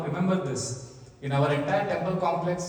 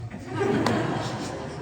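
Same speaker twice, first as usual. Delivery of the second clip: breathy amused chuckle; airy laugh; 12 semitones higher than usual. Real, not acted. Laughs at own exaggeration.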